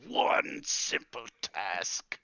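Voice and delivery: very slow, weary, spelled-out words; slow, fed-up sigh of a disappointed wizard; disgusted. Acted. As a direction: drag it out in disgust. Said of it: "exhales in disgust".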